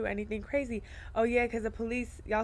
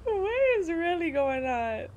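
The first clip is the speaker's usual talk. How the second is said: laughing; 10 semitones higher, slower than usual. Real, not acted. Driver to viewers while a car swerves, helpless laughter. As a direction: squeeze the words out while cracking up.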